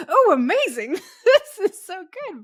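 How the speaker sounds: high, loud laughing delight. Retold or imitated. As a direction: gush with laughing joy. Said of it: "I was like".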